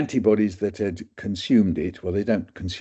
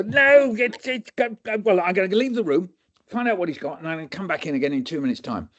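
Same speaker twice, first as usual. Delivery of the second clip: much higher, tumbling then speeding up; flustered doctor; 9 semitones higher than usual. Retold or imitated. Doctor leaves students, high and hurried. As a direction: stammer and rush out.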